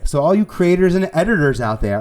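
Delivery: a hint of an over-bright hostess hail; sounding like Carole Baskin. Real, not acted. Speaker hailing all the creators out there, sounding like Carole Baskin. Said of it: "oh, I sound like Carole Baskin".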